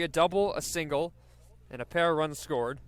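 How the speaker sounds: normal talking voice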